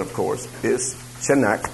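ordinary delivery

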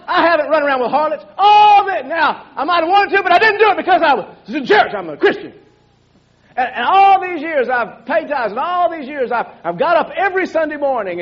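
hammed-up resentful son, voice rising; angry elder brother. Elder brother protesting to his father, mock angry rant. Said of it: "He's mad now".

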